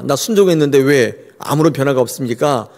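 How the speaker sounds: normal talking voice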